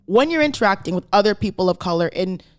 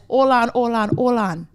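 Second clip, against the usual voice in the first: put-on light Jamaican accent, unplanned; 3 semitones higher than usual. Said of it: "I don't know why I did Jamaican accent".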